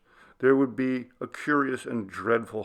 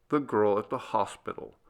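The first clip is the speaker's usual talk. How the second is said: usual speaking voice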